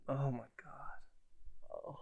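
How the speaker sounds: breathless whispered disbelief; awed whisper. Real, not acted. Host stunned by a one-footed three, whispering. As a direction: whisper it in shock.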